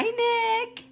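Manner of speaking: ordinary talk, nothing marked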